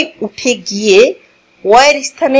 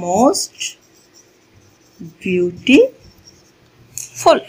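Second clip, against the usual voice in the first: "most beautiful" very slow, with long pauses inside; slow dictation; 4 dB quieter, much slower than usual. Shown as a demonstration. Teacher writing the example for learners, "most beautiful" in slow dictation. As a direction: dictate "most ... beau-ti ... ful" piece by piece. Repeats it slowly while writing it out.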